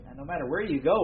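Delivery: normal talking voice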